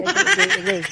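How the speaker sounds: high, loud yelp of agay. Real, not acted. Riding over big rocks, a high loud ouch. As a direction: cry out as the bike jolts.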